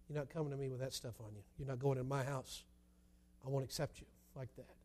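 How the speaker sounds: very soft, low, solemn voice; quiet refusal. Acted. Father turns away filthy child, acted hushed firmness. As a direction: refuse gently but firmly, almost under your breath. God refuses the unclean sinner.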